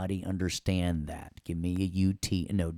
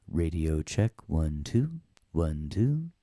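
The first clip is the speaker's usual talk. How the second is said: radio check; 3 semitones lower, slower than usual. Pretend. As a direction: lower radio voice. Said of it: "That is me doing that".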